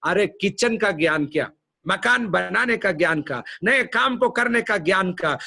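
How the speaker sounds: fervent preacher build; building preaching. Acted. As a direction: climb toward the question.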